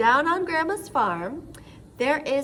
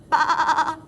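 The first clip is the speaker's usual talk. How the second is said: bleating sheep; 4 semitones higher, 5 dB louder than usual. Acted. Sheep sound for children.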